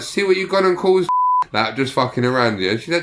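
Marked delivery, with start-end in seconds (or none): 0.0-1.1 high-pitched mock scolding; retold mock reproach. Just messing around after the radio row, mock reproach. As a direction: re-enact needling someone in an argument.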